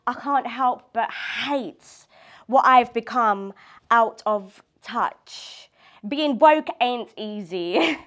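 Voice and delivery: deliberate, emphatic poetry reading; measured lines with punched key words; spoken-word poem. Acted. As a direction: perform your own confessional poem to a small room. Continues own poem.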